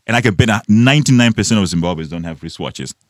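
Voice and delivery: ordinary delivery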